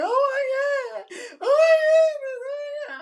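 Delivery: high-pitched tearful wail, acted out; hammed-up crying squeal; emotional outburst. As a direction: wail it like you're overcome. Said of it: "I'm getting all emotional".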